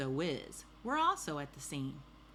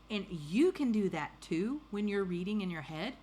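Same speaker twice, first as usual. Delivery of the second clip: ordinary delivery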